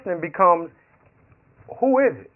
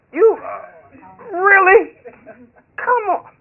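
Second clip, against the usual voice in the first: much higher, whiny disbelief; hammed-up unwilling giver; mock reluctant pleading; 7 dB louder, slower than usual. Reluctantly handing over all the money.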